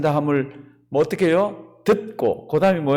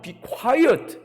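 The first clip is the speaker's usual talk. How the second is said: high-pitched mock rebuke; imitated hushing; 8 semitones higher than usual. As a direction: shush them, acting the passers-by. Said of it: "지나가던 사람들이 좀 잠잠하라고". (잠잠하라는 행인들을 흉내 내듯 높게)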